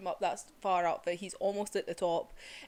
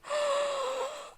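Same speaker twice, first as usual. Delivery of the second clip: a sharp gasp, much higher; excited gasp. Real, not acted. Fan reliving the rescue scene, gasping with joy. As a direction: gasp in delight at the reveal.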